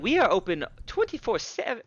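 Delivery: high, squawked tavern keeper protest; indignant tavern keeper. Acted. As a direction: refuse in a high, outraged character voice. Game master voices indignant tavern keeper.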